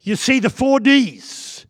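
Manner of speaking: loud full-force sermon; preaching. Acted. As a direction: drive it home to the congregation.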